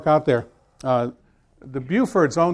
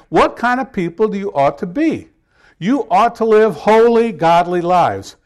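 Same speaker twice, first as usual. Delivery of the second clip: loud, declaiming Bible reading; scripture reading; 6 dB louder than usual. Acted. Pastor reading Second Peter to his congregation, loud proclamation.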